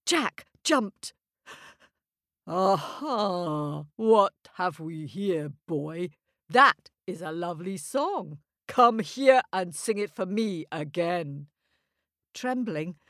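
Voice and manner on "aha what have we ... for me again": put-on a crafty giant sweet-talking the boy; sly giant. Giant speaks.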